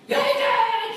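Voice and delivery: put-on high, loud kid's call. Boy calling out to his dad as he comes in, high and loud. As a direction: shout home like an excited boy. Child shouting coming home.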